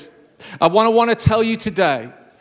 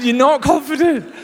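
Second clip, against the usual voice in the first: higher-pitched, laughing tease; 6 semitones higher than usual. Real, not acted. Preacher teasing the half-raised hands, laughing. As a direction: say it through real laughter.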